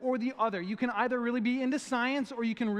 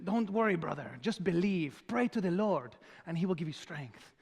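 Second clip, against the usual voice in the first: mock-solemn Mexican-accented reassurance; terrible Mexican accent. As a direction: do a bad Mexican accent, earnest and encouraging. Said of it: "in his terrible, uh, Mexican accent".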